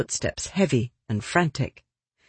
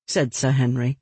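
usual speaking voice

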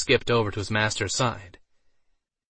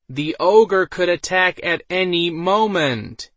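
louder, slow and emphatic; 8 semitones higher, 6 dB louder, slower than usual. A narrator's reading. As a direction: repeat it louder and slower. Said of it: "he spoke in a louder voice".

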